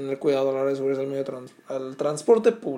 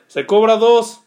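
a touch of an angry snap, higher and louder; 7 semitones higher, 11 dB louder, slower than usual. Retold or imitated. Angry guy answering the passenger, mild angry snap. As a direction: bark the fare back, mildly. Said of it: "Bien envergado".

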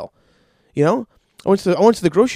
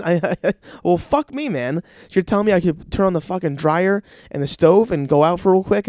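ordinary delivery

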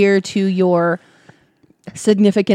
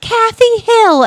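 high, slow, loud drawn-out name; Karen voice; 6 dB louder, slower than usual. Retold or imitated. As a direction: stretch the name out, high and entitled. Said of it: "She's like".

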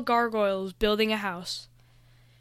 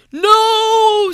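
long, high, loud cry; scream; 11 semitones higher, 12 dB louder than usual. A narrator's reading. Noah as the dark cube seeps into him, long high scream. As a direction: scream the word out, drawn long. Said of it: "screamed Noah".

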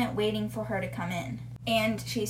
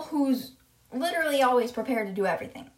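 usual speaking voice

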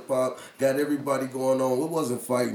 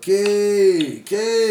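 calling out; 6 semitones higher, 9 dB louder than usual. Real, not acted. Calling out to the guest.

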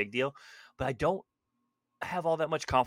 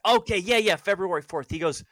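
loud, high airhead voice of a friend; clueless Marky; 5 semitones higher, 7 dB louder than usual. Retold or imitated. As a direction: cheerfully ask what you should already know.

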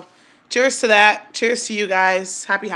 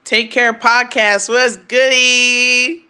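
hyped shout-out; 4 semitones higher, 6 dB louder, much slower than usual. Real, not acted. Hyped podcast shout-out greeting.

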